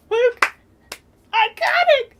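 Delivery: very high, loud fan scream; fangirl shriek. Retold or imitated. Fan retelling cheering at Luke in the theater, high shriek. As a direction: shriek his name like a thrilled fan. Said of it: "I was like".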